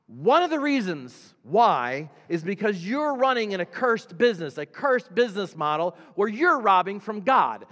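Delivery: loud, high-pitched preacher delivery; full-force preaching. Real, not acted. Preacher warning the congregation about a cursed business, loud and forceful.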